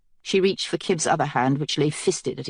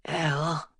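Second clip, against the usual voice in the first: drugged, slurred and quiet; 8 dB quieter than usual. A narrator's reading. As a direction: slur it like he's heavily drugged. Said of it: "His voice was slurred with some kind of narcotic".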